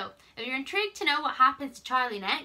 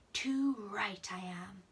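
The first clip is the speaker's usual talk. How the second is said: slow husky whisper; 3 dB quieter, much slower than usual. Acted. Manfred answering the accusation in a husky whisper. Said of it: "said a husky voice".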